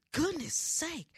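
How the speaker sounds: high-pitched exasperated outburst; frustrated exclamation. Retold or imitated. Frustrated marcher during the ruck march, high exasperated cry. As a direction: let frustration push the pitch right up. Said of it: "I'm getting real frustrated".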